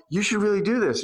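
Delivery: a guide speaking to someone who listens. Retold or imitated.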